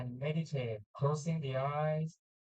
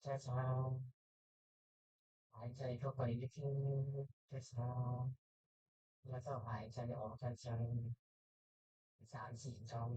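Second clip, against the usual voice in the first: soft spa whisper; 2 semitones lower, 7 dB quieter, much faster than usual. Acted. Guides breathing in meditation.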